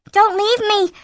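high, loud frightened plea; crying out. A narrator's reading. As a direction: cry out after him in panic. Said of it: "Elise cried".

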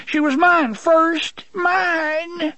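high, slow, wailing plea through tears; a jilted little man weeping over his lost woman. Acted. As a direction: cry it out in a high broken voice. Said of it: "he wept".

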